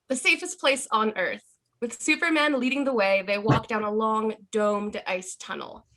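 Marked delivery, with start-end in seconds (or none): none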